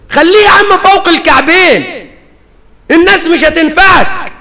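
loud, high rebuke to the man; scolding. Pretend. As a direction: tell him off sharply.

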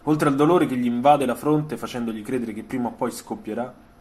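ordinary talk, nothing marked

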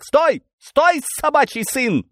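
high, loud, threatening yell; high-pitched police shout, drawn out; menacing shout. Acted. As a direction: shout to halt a fleeing man. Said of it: "грозно вскричал один из них".

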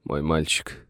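subdued, tearful murmur; sobbing lament. A narrator's reading. Father mourns his boy, mildly sobbing. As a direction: grieve softly for a lost son. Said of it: "всхлипывая и шмыгая носом".

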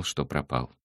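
ordinary delivery

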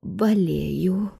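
weak, put-on sickly voice; faint, ailing excuse; feeble invalid. A narrator's reading. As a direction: feign illness softly. Feigning illness on the phone.

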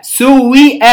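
normal talking voice

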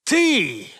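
high, loud angry roar. Acted. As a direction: roar the word at him. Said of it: "проревел".

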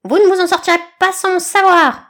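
high, loud grandiose eagle voice; pompous eagle orating. A narrator's reading. Eagle lecturing the group, high and pompous. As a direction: hold forth grandly. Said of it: "pérora le Raffaello".

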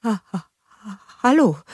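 breath-broken, halting greeting; surprised stammer. A narrator's reading. Envoy startled by a talking snowman, halting stammer. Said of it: "stammelte der Abgesandte überrascht".

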